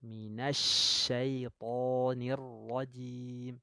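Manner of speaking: minasyaitonir rajim drawn out slowly; correct recitation. Shown as a demonstration. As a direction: recite slowly, sh from mid tongue. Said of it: "Keluarnya dari tengah lidah".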